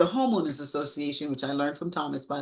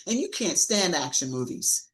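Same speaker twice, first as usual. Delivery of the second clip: ordinary talk, nothing marked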